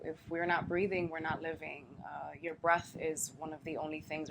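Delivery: ordinary talk, nothing marked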